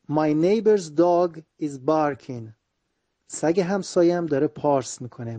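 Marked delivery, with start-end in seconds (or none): 0.1-2.5 slow, clear example sentence; slow model reading. Shown as a demonstration. Teacher reading an example sentence slowly to students. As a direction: read the example slowly for learners. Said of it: "teacher models sentence slowly".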